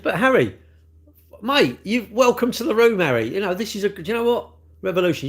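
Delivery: high-pitched, exasperated telling-off; exasperated reproach. Real, not acted. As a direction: tell off a pest, voice climbing high and loud. Exasperated reproach to commenter Harry.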